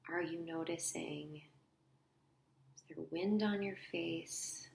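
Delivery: soft, unhurried guide with long pauses; quiet guided-visualization voice; guided meditation. Acted. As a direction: lead them gently inward, leaving space between phrases.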